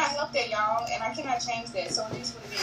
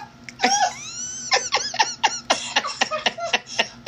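a big high burst of laughter; real helpless laughter at herself. Real, not acted. Sees herself on screen.

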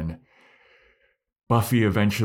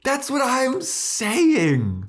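high, excited yell of agreement; emphatic shouted agreement; 7 dB louder, slower than usual. Real, not acted. Co-host backing up a fandom complaint, excited shout. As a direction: shout it, voice soaring, stretching the words.

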